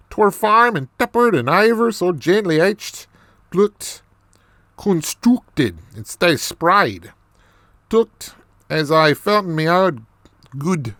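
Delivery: lilting, animated mock Irish brogue; Irish accent. Storyteller amazed at what is in the loo, Irish accent. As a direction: play it up in a broad put-on Irish accent. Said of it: "more of this Irish accent".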